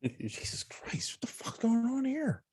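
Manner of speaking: shocked under-the-breath whisper; a startled aside whispered to himself. Retold or imitated. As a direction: whisper it in disbelief. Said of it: "I'm like".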